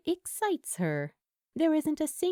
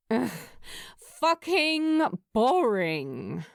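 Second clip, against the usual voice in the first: slow, louder fed-up groan; bored groan; 3 semitones higher, 4 dB louder, slower than usual. Acted. Steed groaning to herself about her dull life, fed up. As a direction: drag it out like it's torture.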